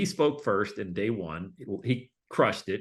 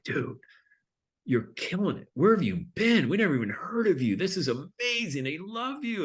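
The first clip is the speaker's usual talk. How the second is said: high, gushing praise; mock thrilled company owners, pitched up; excited owners; 8 semitones higher than usual. Owners backstage praising the speaker, high excitement.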